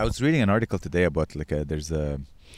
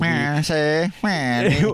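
mock bigot voice; 7 semitones higher, much slower than usual. Mock racist old-timer voice.

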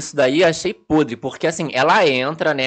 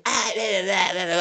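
mock whining; 4 semitones higher, 6 dB quieter than usual. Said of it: "ficar nessa de".

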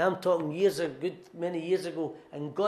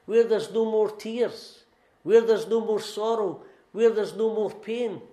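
impassioned preaching; 5 semitones higher, 7 dB louder than usual. Real, not acted. Preaching crescendo.

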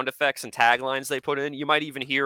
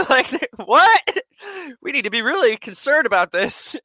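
much higher, louder shocked outburst; mock alarm; 13 semitones higher, 10 dB louder than usual. Hearing Russia invading Georgia, shocked shout. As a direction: panic at the news like a fool. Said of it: "And I'm like".

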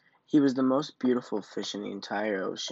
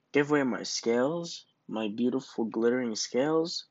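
plain reading voice

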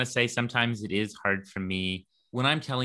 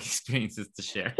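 usual speaking voice

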